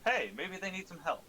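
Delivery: usual speaking voice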